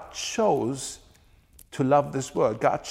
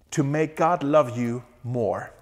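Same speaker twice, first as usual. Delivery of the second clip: ordinary talk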